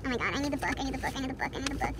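low, soft repeated plea; urgent muttering. Real, not acted. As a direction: mutter it over and over to yourself.

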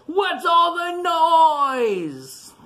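high, slow, drawn-out shout of a woken bear; grumpy bear shout. Acted. Grumpy bear yelling at the noise, drawn-out high shout. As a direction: bellow it out, sleepy and cross. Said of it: "the grizzly bear says".

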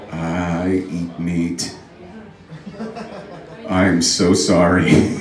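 very slow, low admission; hammed-up remorse; mock guilty confession. Guilty meat eater among vegans.

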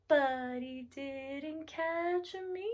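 soft, very slow singsong punchline; playful gloating. Acted. Telling children the turtle missed her, playful gloating. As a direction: stretch each word out softly.

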